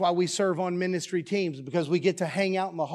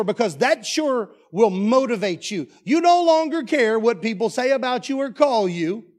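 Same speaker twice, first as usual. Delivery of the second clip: louder and higher, slowing on key words; full-force preaching; 5 semitones higher, 7 dB louder than usual. Acted. Pastor declares his certainty of heaven to the congregation, loud and fervent. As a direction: drive it home like a revival preacher.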